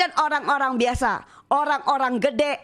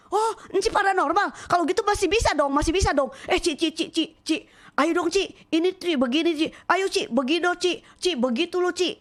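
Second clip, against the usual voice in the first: much higher, fast, eager coaxing; eager pleading; 7 semitones higher than usual. Retold or imitated. Woman excited to hear she was a psychic, high and eager. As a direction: press her eagerly, Ci Ci Ci.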